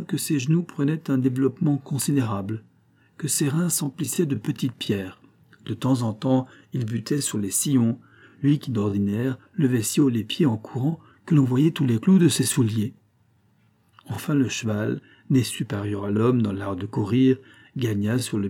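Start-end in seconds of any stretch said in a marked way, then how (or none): none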